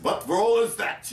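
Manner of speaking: high-pitched mimicked challenge; Otto Preminger. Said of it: "Otto Preminger with his accent".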